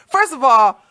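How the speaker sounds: ordinary talk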